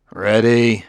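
gruff, loud one-word growl. Acted. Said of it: "growled Bailey".

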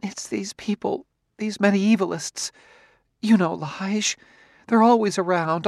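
rambling, nervous wife. A narrator's reading. Wife voiced in story.

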